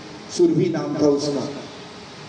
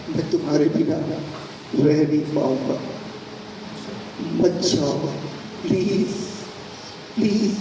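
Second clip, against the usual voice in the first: halting, broken begging, rising on each please; tearful pleading; 3 dB quieter than usual. Acted. Husband cannot live without his wife, tearfully begging. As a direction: plead helplessly, phrase by phrase. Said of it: "उसकी आंखों में आंसू था".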